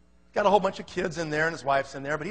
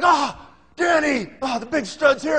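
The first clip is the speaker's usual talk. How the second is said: high, slow mock-adoring welcome; fawning relatives; 7 semitones higher than usual. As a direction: gush over the arriving star. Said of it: "and they're like".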